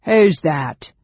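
loud, suspicious goblin challenge; suspicious demand. A narrator's reading. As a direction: call out sharply to challenge strangers. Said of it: "the goblin demanded suspiciously".

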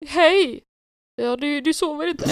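high, loud hello on the phone; imitated cheery dad. Dad phones daughter late at night, imitated eager greeting.

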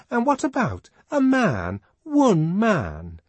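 high, loud, incredulous objection; energetic protest. A narrator's reading. Fanny dismissing Lenina's fuss over one man, protesting. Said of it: "Fanny was energetically protesting".

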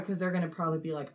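ordinary talk, nothing marked

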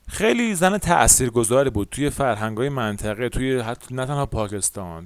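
usual speaking voice

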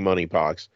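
"monkeypox" said as money-pox; silent K. Shown as a demonstration. Host keeping up the joke while reading the advisory, "monkeypox" with silent K. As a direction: leave the K out of "monkeypox", so it starts like money. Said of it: "The K is silent".

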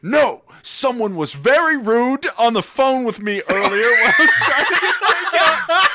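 high, hammed-up indignant rant; mad old granddad. Granddad answering his children about rude phone call, mock angry. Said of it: "why are you so mad".

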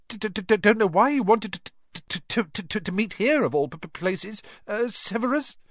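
high, stammering, frightened Quirrell; nervous stutter. A narrator's reading. Quirrell stammering to Snape, high and nervous. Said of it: "he was stuttering worse than ever".

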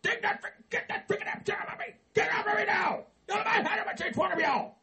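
a man at the end of his rope, yelling; hammed-up furious yelling; fed-up shouting.

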